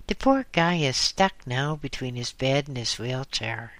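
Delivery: plain reading voice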